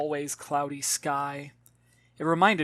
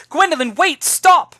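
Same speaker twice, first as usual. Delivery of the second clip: shouted high, loud call; 13 semitones higher, 14 dB louder than usual. Acted. Mother shouting after her daughter running away. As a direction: yell after the running child.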